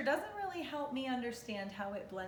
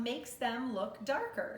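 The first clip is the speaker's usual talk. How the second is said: ordinary talk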